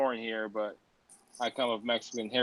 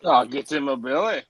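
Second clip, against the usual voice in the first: Fat Bastard impression; 5 dB louder than usual. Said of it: "fat bastard".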